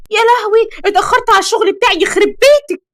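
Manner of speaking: high-pitched, hurried wail of dismay; flustered alarm. Acted. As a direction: exclaim in a panic at being late. Late for work, panicked exclamation.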